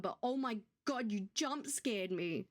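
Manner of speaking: ordinary delivery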